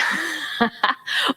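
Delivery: brief high chuckle; wry little laugh mid-sentence; soft amused giggle; light laugh. Real, not acted. Chuckles after wry remark.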